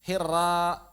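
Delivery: "hira" with a light, thin A; wrongly thinned ra. Shown as a demonstration. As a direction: lighten the r of "hira" where it should be heavy. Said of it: "menipiskan suara saat tafkhim". ("hira" keliru ditipiskan)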